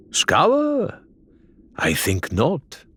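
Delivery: smirk through it; mischievous Faisal. A narrator's reading. Faisal teases Adelaide about scouring the island, high and mischievous. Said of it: "a mischievous grin on his face".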